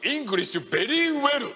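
slow comic English. Acted. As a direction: spell out each English word slowly and stiffly.

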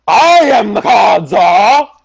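slow booming announcement; proud shout. Acted. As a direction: declare it loudly, drawn out. Shouting the card czar role.

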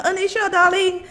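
high, loud call across the room; calling out to Anisha. Real, not acted. Host calling her friend Anisha, a loud high call. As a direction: shout her name to reach her. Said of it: "Let me shout at my girl".

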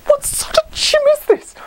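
very high, cracking cry of disbelief; incredulous squeal. Retold or imitated. Outraged at the rusted gym, squealing disbelief. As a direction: shriek it like you can't believe it.

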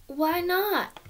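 high, pushed-up protest; indignant why not. Acted. Abby refused the deal, high indignant protest. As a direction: demand an answer.

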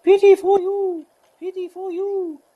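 high piping bird call, said twice, falling; whistle-like bird voice calling pity for you; kingfisher call imitation. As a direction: mimic the brown hooded kingfisher's cry up high. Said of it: "the call of the brown hooded kingfisher going".

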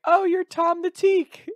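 put-on high, slow, sing-song girl voice; mock girly voice. Imitating the girl who picks him up, high and slow.

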